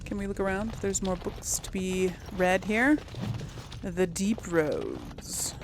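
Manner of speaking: ordinary talk, nothing marked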